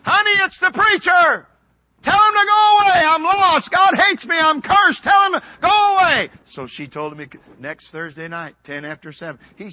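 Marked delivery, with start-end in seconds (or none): 0.0-1.5 wife's high, loud call; hollering. Retold or imitated. Wife hollers to husband again. 2.0-6.3 very high, loud acted shouting; yelling back. Husband yells back again.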